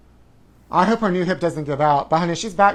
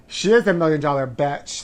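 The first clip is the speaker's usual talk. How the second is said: ordinary talk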